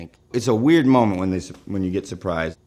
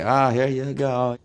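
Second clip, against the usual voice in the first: a hint of a put-on Brooklyn accent, slow and higher; fake Brooklyn accent; 5 semitones higher than usual. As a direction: slide the change over with a mild Brooklyn twang. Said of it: "my Irish accent, my Brooklyn accent".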